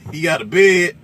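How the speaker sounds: high, loud excited sales pitch; imitating the trucker. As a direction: hype it up like the trucker on the radio. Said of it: "he was like".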